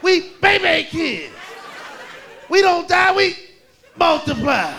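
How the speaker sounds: put-on bratty kids bragging; mock kid boast. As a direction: brag like a tough little kid. Kids' boastful chant.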